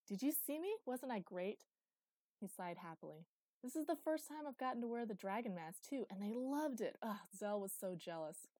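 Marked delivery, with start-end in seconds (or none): 0.1-1.7 put-on high, loud, eager boy; excited boasting. A narrator's reading. 3.6-8.5 put-on higher, louder, thrilled boy voice; excited gushing. A narrator's reading. Boy gushing about the mask.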